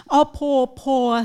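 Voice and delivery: "Op, po, po" with the R coloring the schwa; R-colored syllable drill. Shown as a demonstration. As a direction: glide "po" into an R sound. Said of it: "The R will take over the chwa".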